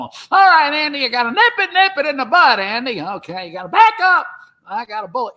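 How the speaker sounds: high-pitched, agitated deputy impression; Barney Fife. Comic imitating the deputy on stage, high excitable impression. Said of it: "me doing him as Barney Fife going".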